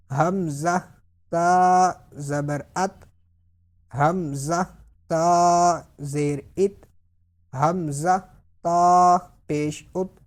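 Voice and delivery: measured chant-like spelling, long held "تا"; drawn-out letter-by-letter recitation; spelling drill. Shown as a demonstration. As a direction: spell out slowly, stretching "تا", then a short "ات". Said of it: "اب practice کرتے ہیں".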